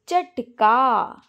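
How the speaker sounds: चटका stretched out very slowly; slow demo word. Shown as a demonstration. Teacher naming the bird word for students, very slow. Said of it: "चिड़िया को कहा जाता है".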